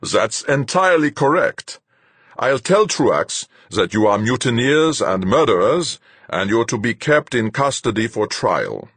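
commanding captain, cold; stern captain. A narrator's reading. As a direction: lay it out like a hard captain. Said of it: "Fuchs laughed harshly".

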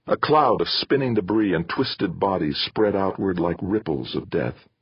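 plain reading voice

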